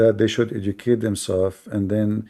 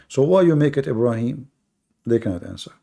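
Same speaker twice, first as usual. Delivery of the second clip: ordinary delivery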